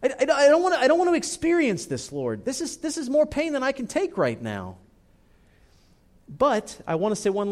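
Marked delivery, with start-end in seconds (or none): 0.0-4.8 high, strained cry of protest to God; raised, pained lament; anguished cry. Retold or imitated. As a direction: cry out why, near breaking. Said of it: "I cry out to God and I say".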